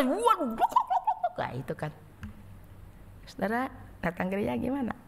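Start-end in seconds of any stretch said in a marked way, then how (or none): 0.1-1.3 gulping sounds. Acted. Gulping water at oasis.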